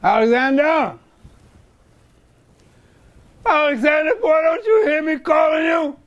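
calling a name, building to a shout. Acted. Father calling son repeatedly.

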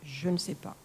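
low, soft murmur; softly dropped, hesitant admission. A narrator's reading. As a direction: murmur it low, unsure of the answer. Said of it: "puis murmura".

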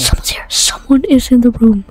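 breathless hush; tense whisper. Real, not acted. Gamer warns his friend of an intruder, whispering. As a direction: whisper the warning under your breath.

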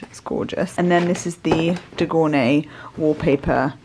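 ordinary delivery

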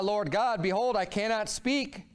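usual speaking voice

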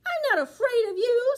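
loud, high brave declaration; plucky old lady facing the pumpkin head. Acted. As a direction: stand tall and say it boldly. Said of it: "said the little old lady bravely".

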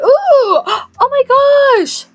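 high, loud ooh and gasp; amazed delight. Real, not acted. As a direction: squeal slowly in amazement. Amazed at what just loaded.

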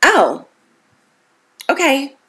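hesitant, unsure "oh, okay"; an awkward, unconvinced thank-you; awkward brush-off. Retold or imitated. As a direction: shrug the compliment off uneasily. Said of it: "first thing you wanna say is".

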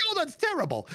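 very high mock-mom voice; high-pitched retold dismay; appalled mother.

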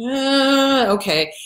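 drawn-out hesitant noise, then giving in; reluctant agreement. Acted. Toad giving in to the scorpion, acted reluctant okay.

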